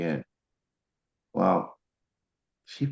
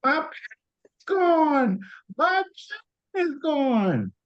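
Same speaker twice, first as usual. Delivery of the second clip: much higher joyful cry; excited scream; slower than usual. Retold or imitated. Husband voicing his wife excitedly screaming her pain is gone. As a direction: shout the good news. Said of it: "What she was screaming is".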